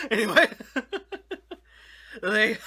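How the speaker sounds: talking through a giggle fit; laughing at own joke. Real, not acted. Host cracking up at his own channel shout-out joke, laughing. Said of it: "laughing at my own joke there".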